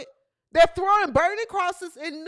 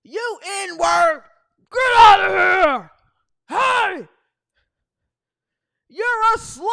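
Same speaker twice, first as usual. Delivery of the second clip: loud, higher shout of a hateful fan; 3 semitones higher, 8 dB louder, slower than usual. Retold or imitated. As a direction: yell it like a fan from the stands. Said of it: "if people were yelling".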